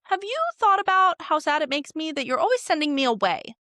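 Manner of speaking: hammed-up hurt younger brother; whiny brother. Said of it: "Daemon is like".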